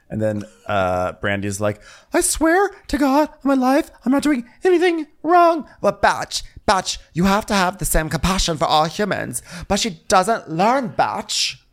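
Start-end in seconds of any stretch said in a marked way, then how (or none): 2.1-11.6 much higher, breathy-pitched mock Brandy; Brandy impression. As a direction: squeak it out like a flustered Brandy. Brandy voiced in recap.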